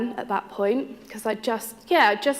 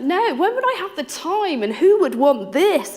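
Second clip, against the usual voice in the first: indignant retort; 8 semitones higher, 4 dB louder than usual. Retold or imitated. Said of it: "I was like".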